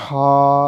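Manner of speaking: ہا sounded loud from mid-throat; throat sound demo. Shown as a demonstration. Teacher demonstrates throat letter, loud ہا. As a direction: push ہا out from the middle of the throat. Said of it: "اس کو پڑھنا ہے".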